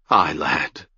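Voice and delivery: a soft, hurting reassurance; gentle, with pain. Acted. Ged gently answering Aron's words of love, gentle and pained. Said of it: "gently and with pain".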